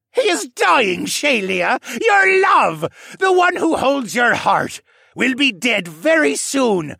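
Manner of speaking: high, loud, ranting villain; deranged villain roar. A narrator's reading. Villain demands she change the waters, roaring and deranged. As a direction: taunt her at full roaring volume. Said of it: "the deranged man roared again".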